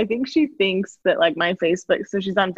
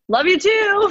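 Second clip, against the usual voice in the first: sweet high reply; 11 semitones higher, slower than usual. Retold or imitated. Reply to grandma.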